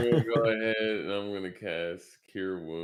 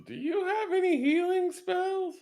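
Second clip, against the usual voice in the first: very high put-on character voice; high, cartoonish little voice; in-character question. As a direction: ask in a squeaky falsetto. Character addresses Crystal in game.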